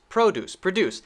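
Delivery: PRO-duce, then pro-DUCE; first "produce" stressed on PRO, second on DUCE; alternating stress. Shown as a demonstration. Teacher contrasts noun and verb, stress moving from PRO to DUCE. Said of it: "but the stress is different".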